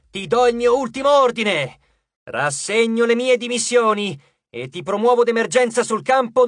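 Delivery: drill sergeant shout. A narrator's reading. Jason promoting Frank to praetor, shouted sergeant voice. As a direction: bellow it like a drill sergeant giving orders. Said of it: "Con la sua migliore voce da sergente istruttore, Jason gridò".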